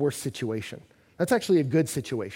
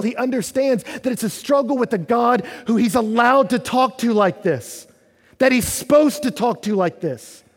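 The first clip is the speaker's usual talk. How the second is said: raised, higher preaching voice; forceful preaching; 8 semitones higher, 8 dB louder than usual. Real, not acted. Preacher encouraging listeners to talk to God, forceful. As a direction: press each point home loudly.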